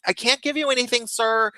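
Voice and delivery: high, fast nurse voice. Retold or imitated. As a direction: brush the patient off quickly. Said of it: "she's like".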